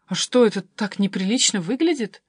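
ordinary delivery, nothing marked

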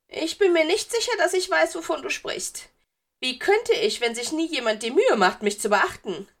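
put-on high, loud, wounded ghost girl; beleidigtes Geistermädchen. A narrator's reading. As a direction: whine that you are being neglected. Narrator voices ghost girl. (beleidigt, hoch und laut klagend)

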